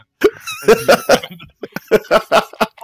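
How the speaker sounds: helpless high laugh; high loud laughing fit. Real, not acted. Laughing at his own threat, high squealing laugh.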